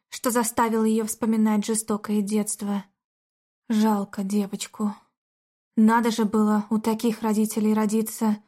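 ordinary delivery, nothing marked

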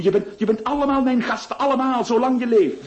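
high, fast and eager; overflowing promise; excited. Acted. Notary's overjoyed promise.

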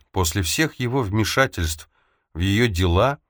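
plain reading voice

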